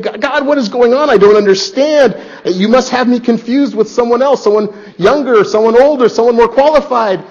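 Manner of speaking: preacher voices a confused believer questioning God's call, pleading; perplexed plea. Retold or imitated. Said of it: "That call is perplexing".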